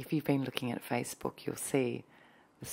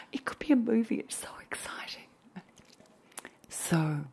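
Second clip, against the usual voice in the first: breathless thrilled whisper; hushed excitement; excited whisper; 9 semitones higher than usual. Acted. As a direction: whisper it like a secret you can't contain.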